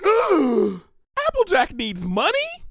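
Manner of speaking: high, slow, stretched disbelief; shocked pony. A narrator's reading. Pinkie shocked to hear Applejack needs money, high and slow. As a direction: gasp out the news in shock. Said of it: "made no secret of her surprise".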